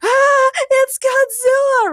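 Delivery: very high, slow, loud shriek; shouting. A narrator's reading. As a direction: stretch it into a monster-movie scream. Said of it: "Ray and James shouted simultaneously".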